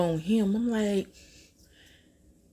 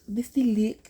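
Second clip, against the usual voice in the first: showing the look; 6 semitones higher, 6 dB louder than usual. Shown as a demonstration. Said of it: "by the way, this the look".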